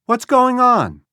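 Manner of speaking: high, loud, slow call; yelling. A narrator's reading. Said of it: "Becky yelled".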